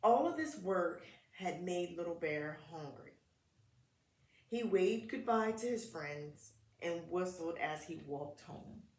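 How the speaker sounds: put-on gentle read-aloud for kids; children's story narration; storybook reading. A narrator's reading. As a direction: read it like a picture book at story time.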